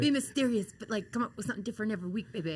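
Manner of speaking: lightly imitated coach, rushing through the order; Dusty Rhodes. Wrestler mimics her mentor demanding new promos, lightly imitated. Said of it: "he used to always be like".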